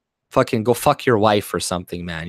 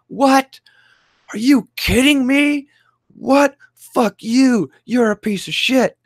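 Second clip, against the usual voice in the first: shrill mock outrage, much higher and louder; mock triggered outrage; 13 semitones higher, 4 dB louder, slower than usual. Streamer mocking triggered older people, high outraged squeal. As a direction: squeal like an offended old man. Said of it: "look how triggered people get".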